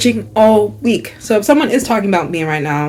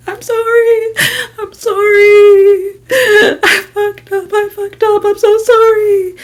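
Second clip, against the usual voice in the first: high, wailing imitation of her sobbing apology; mock bawling; 13 semitones higher, slower than usual. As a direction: wail it like a crying roommate, dragging the sorry. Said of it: "she's crying, bawling".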